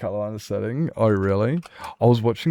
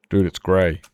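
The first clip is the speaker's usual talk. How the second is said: usual speaking voice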